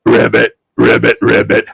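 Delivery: low frog croak. Acted. Frog in the story.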